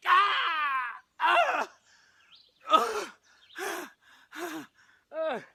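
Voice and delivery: loud, high yelps of pain; hammed-up death cries, one after another; stabbed cries. Actor plays Mercutio stabbed, hammed-up yells.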